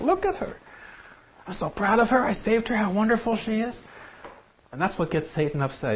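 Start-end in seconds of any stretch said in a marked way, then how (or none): 0.0-3.8 higher, a gentle proud father; a hint of tender pride in her; the Father proudly pointing her out to the angels; proud Heavenly Father. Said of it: "This is the Heavenly Father saying".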